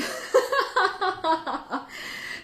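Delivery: a bright high giggle, falling; high self-deprecating laugh. Real, not acted. Host laughing at her homemade tea, high giggle.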